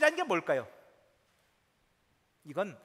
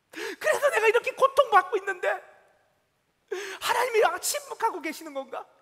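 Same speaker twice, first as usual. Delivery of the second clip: anguished doubt; 5 semitones higher, 4 dB louder than usual. Acted. Psalmist's anguished inner questions.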